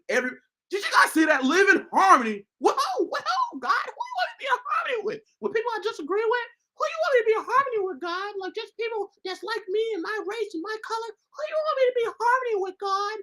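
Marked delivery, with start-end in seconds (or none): 2.6-13.2 much higher, squeaky mock-whiny questioning; Pinocchio voice. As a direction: play a petulant puppet arguing with God. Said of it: "I know I sound like Pinocchio".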